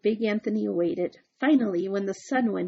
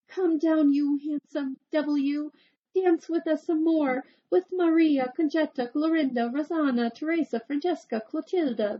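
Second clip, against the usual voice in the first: higher, loud coaxing call; ladies crying out; 6 semitones higher, 3 dB louder than usual. Acted. Ladies shaking the tree at Big Anthony, higher loud calls. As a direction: call him down like eager suitors. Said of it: "they cried".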